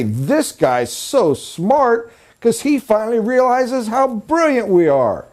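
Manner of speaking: high-pitched mock awe, drawing out the key words; flattered and astonished, pitch swooping up; amazed delight. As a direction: gush like someone thrilled to be listened to. Acting out the flattered talker's amazed thoughts.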